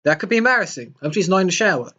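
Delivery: usual speaking voice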